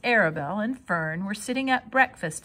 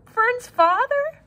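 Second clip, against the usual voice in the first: high slow sobbing; 8 dB louder, slower than usual. Acted. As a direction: sob out the question. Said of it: "sobbed Wilbur".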